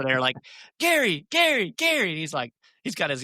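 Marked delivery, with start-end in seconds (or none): 0.8-2.1 high, louder reenacted shout for attention; excited calling out. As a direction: call his name out eagerly from afar. Running over, calling to a golfer.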